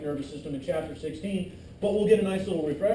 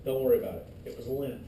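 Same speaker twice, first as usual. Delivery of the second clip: calming voice; 7 semitones lower than usual. Pretend. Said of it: "The uppers can say".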